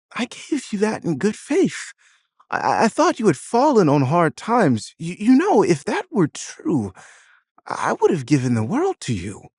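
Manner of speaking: high, wavering, hurt mother's voice; tearful hurt. A narrator's reading. Mother betrayed by her son, high tearful hurt. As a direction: plead through tears of betrayal. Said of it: "tears welling up in her eyes".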